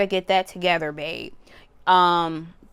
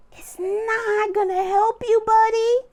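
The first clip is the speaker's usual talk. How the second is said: mock scolding; 14 semitones higher, 4 dB louder than usual. Scolding someone shitting on others.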